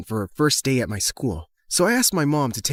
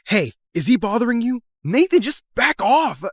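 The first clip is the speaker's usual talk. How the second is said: high, raised-voice warning; angry confrontation; 9 semitones higher, 4 dB louder than usual. Acted. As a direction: jump in and warn the bully away. Confronts rival bothering friend.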